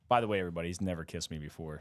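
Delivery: ordinary talk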